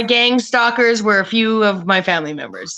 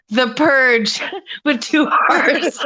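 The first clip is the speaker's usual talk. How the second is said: cracking up mid-phrase, slowed; real giggles breaking through the words; laughing; 3 semitones higher, slower than usual. Real, not acted. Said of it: "Sorry, I cracked myself up".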